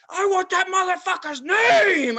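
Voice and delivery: high, loud, slow demand; furious yell for his name; retold yell. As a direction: bellow it from the moving ride. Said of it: "I was just yelling".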